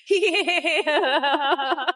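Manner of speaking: a high laugh that rolls into a pleased yeah; gleeful high cackle; cackling laugh. Acted. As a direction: laugh at your own insult. Bot laughs at own insult.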